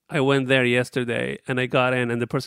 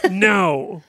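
aggressive no; 6 semitones higher than usual. Retold or imitated. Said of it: "I said it way too aggressively".

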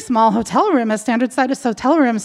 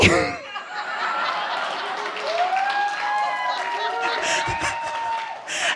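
big laugh; 10 dB quieter than usual. Real, not acted. Audience and teller laugh at ridiculous request. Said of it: "And everybody laughs".

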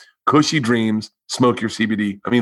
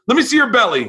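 eager request; 11 semitones higher, faster than usual. Retold or imitated. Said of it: "He goes".

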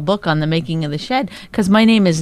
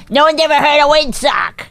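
mock berating; 5 semitones higher than usual. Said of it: "That's berating".

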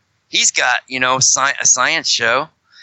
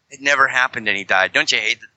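ordinary delivery

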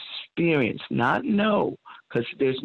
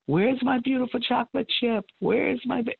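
put-on much higher, beaming call to his little girl; father's delighted greeting; 10 semitones higher than usual. Father walking in the door, calling for his daughter, delighted high voice. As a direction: sing it out like a doting dad at the door.

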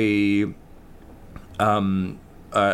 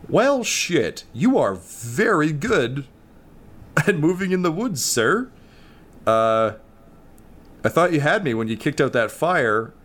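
impressed dog; 5 semitones higher than usual. Acted. GM voices Toby, impressed.

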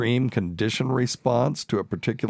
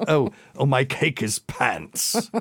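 laughing mock British lady; 6 semitones higher, slower than usual. Mocking a fake British speaker, laughing mock English accent. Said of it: "Yeah, pants".

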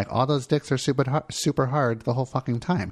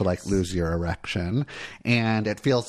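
ordinary delivery

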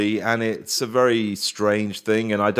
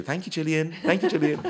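mimicking fans' catchphrase; 6 semitones higher, 5 dB quieter than usual. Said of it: "They all say it to you".

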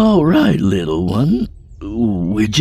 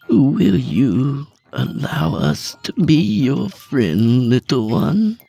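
gentle coaxing; 9 semitones higher, slower than usual. A narrator's reading. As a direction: coax a wild creature into trust. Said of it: "he said gently".

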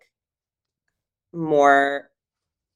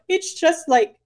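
crying; 3 dB louder than usual. Retold or imitated. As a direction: wail it like a drunk crying girlfriend. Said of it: "I started crying".

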